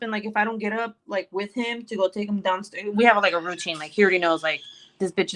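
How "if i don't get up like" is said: usual speaking voice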